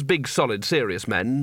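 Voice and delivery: plain reading voice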